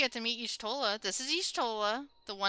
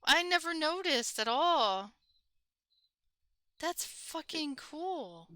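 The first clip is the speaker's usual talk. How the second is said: amazed; 6 semitones higher, much slower than usual. Real, not acted. Amazed at discovery.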